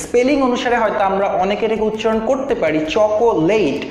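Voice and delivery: usual speaking voice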